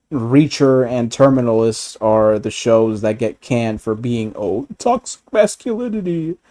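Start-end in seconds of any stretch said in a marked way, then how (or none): 4.3-6.4 high whiny mock voice, much higher; mocking whiny critic. Mocking critics who cancel shows, a high whiny voice. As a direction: sneer it like a whining critic.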